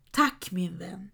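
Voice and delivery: usual speaking voice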